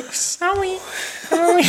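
high, squeaky laughing apology. Real, not acted. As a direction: giggle through the sorry.

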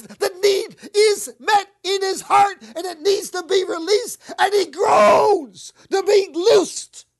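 loud, slow, impassioned preaching; fervent preaching. Real, not acted. Preacher pleading loudly with the congregation about Jesus' pain. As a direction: lean in and preach it hard.

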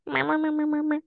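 high mock duck noises. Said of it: "He was like".

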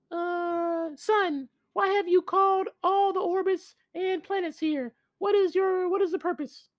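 very high, eerie spirit voice; a far-off high otherworldly voice; West spirit voice. Acted. West orbit questions the sun.

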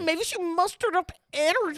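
high mocking copy of friend; parroting his excuse in a high voice; mocking imitation. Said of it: "you're trying to do my mimicking voice".

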